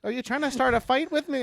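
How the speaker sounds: high, lilting mock Minnesotan; Minnesotan-Canadian accent. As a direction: pick a fight in an unthreatening Canadian-Minnesotan accent. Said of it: "the Minnesotan people with the Canadian accent".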